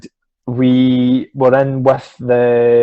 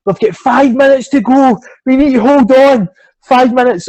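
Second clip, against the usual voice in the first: higher, louder, urging teammates on; retold mid-match urgency, pressing; urgent countdown; 10 semitones higher, 4 dB louder than usual. Player urging team to hold the lead, urgent. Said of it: "I was counting down".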